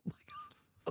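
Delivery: breathless unvoiced whisper; whispered oh my God. Real, not acted. Cohost whispers her shock at the Justin story, whispered. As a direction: hush it out in disbelief.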